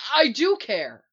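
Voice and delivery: loud, stung retort; forceful, heated protest; shouting back. A narrator's reading. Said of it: "Barry shouts back".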